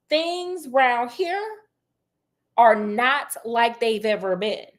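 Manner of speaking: slow, rising spooky tease; slow, dramatic foreboding; ominous warning. Acted. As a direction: stretch it out to build suspense.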